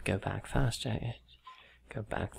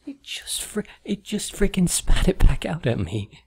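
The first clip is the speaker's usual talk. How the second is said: frustrated outburst; 9 semitones higher, 11 dB louder than usual. Real, not acted. Frustrated at the Wii.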